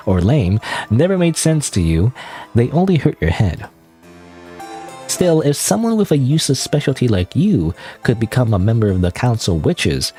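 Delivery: plain reading voice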